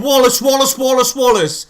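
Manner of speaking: loud, high, hammering the name; repeated angry yell. Retold or imitated. Fan repeating his warnings about Wallace, loud angry yell. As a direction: shout the name over and over.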